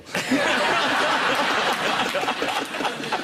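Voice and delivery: high, loud burst of laughter. Real, not acted. Laughs at kids' reaction.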